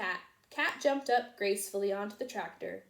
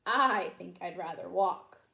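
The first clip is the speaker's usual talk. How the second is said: lightly voiced horse; 4 semitones lower than usual. A narrator's reading. Horse's inner voice.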